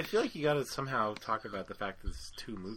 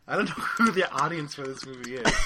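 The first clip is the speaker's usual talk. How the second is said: laughing; 7 semitones higher, 11 dB louder than usual. Real, not acted. Laughing outburst at the pitch.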